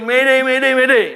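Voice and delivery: urgent retold cry for help; imitated distress call. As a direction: act out a passenger calling mayday over the radio. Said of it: "Dia teriak ya".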